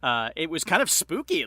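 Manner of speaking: ordinary talk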